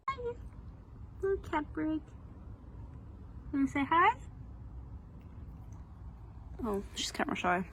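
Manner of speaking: soft pet talk. Real, not acted. Reader chatting to a camera-shy cat, soft pet talk. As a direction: coo quietly to a pet.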